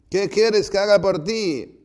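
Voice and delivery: very loud, high, resounding shout; strong voice. Retold or imitated. Jesus asking the blind man, in a powerful shout. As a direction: hurl the question out so it resounds. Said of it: "van a tener un habla fuerte".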